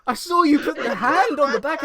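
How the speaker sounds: high, loud laughing protest; laughing loudly. Real, not acted. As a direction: laugh through the words. Laughing at Bill's mic.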